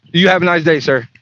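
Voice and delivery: ordinary delivery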